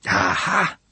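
high, piercing character call; urgent-sounding shrill calling out; shrill cry. A narrator's reading. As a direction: cry out shrilly, pitched far up. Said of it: "Manon cried shrilly".

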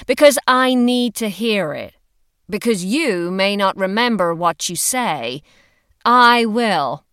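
loud, emphatic bossy friend; insistent friend laying down the law; forceful Heidi. A narrator's reading. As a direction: push the point firmly and loudly. Heidi insisting Hollis use speaker.

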